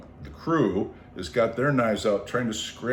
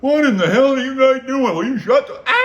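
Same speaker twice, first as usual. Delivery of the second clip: much higher, louder furious shout; angry neighbour yell; 10 dB louder than usual. Retold or imitated. The guy next door yelling at their noise, furious. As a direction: bellow at the noisy neighbours.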